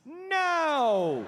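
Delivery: high, drawn-out exclamation; emphatic denial. Acted. Comic answering friends who ask if he's nice, emphatic denial. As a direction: belt out one long, falling syllable.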